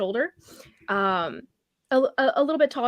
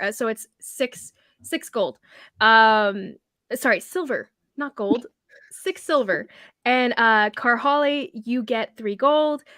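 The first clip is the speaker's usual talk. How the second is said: ordinary talk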